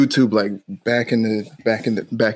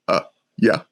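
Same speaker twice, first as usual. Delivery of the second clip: low, offhand, dismissive grunt of a yeah; a lightly voiced dismissive Brendan Fraser; Brendan Fraser impression; 6 semitones lower than usual. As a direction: brush it off like a bored movie hero. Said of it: "a Brendan Fraser impression".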